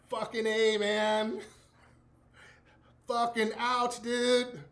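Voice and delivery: mock the actor's complaint; imitating an actor nursing a kick; hurt actor. Actor breaking character in pain.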